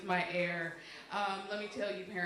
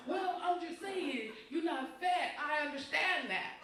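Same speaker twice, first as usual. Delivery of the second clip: mock coworker; 5 semitones higher, slower than usual. Coworker voiced, slow and higher.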